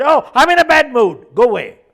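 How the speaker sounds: loud, high snapping mother; mother yelling she's in a bad mood; irritated shout. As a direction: snap at the kid to leave. Mother snaps at son.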